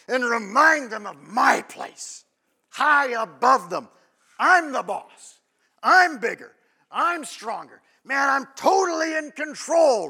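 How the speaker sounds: hammed-up claim of total control; mock domineering boast. Preacher acting out the dad who lords it over his kids, loud and boastful.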